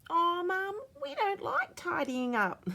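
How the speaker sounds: put-on high, loud childlike whine; high kid's whine about chores; whiny little kid. A narrator's reading. Kids whining at tidy-up time.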